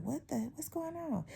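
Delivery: high, soft confused coworkers; mock bystander. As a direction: play the baffled onlookers. Imitating onlookers' confusion.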